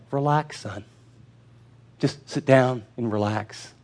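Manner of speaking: soft, low, slow fatherly; calm reassurance. Retold or imitated. Father telling his worried son to sit down, softly and slowly. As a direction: ease him down gently.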